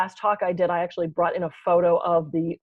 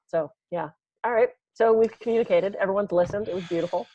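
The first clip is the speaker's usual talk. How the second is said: ordinary talk, nothing marked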